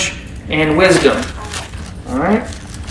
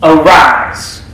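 a firm, louder command to rise; 2 semitones lower, 4 dB louder than usual. Acted. Jesus commanding the dead girl to rise, firm and loud. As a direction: order her up, firm and strong. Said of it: "gave her a command".

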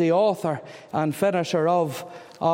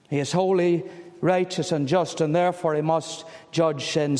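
ordinary talk, nothing marked

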